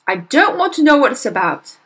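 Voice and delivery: loud, harsh bark; barking father. Acted. Father barking at his daughter to stop talking. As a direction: cut her off with a bark. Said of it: "Mister Wormwood barked".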